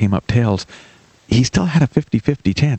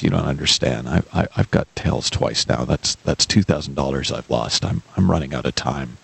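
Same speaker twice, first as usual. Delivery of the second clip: sadly; 9 semitones lower, 4 dB quieter than usual. Acted. Gloomy friend tells the helper he is losing, lower and softer. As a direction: lower and softer, sad and defeated. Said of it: "looks at you really sadly and says".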